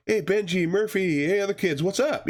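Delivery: high, laid-back stoner greeting; stoner dude voice. Retold or imitated. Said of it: "the [name] guy is like".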